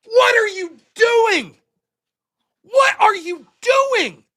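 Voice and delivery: slow, high, incredulous shout; exasperated shout, each word stretched; losing it. Retold or imitated. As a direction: yell it in drawn-out, re-enacted disbelief. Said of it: "I lost my shit".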